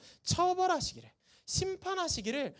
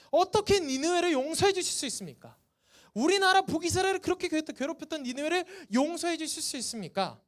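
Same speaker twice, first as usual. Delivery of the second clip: raised, louder voice of a protester; indignant protest; 5 semitones higher, 4 dB louder than usual. Acted. Jonah protests to God over Nineveh being forgiven, indignant. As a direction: demand an answer as if wronged. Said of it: "항의합니다".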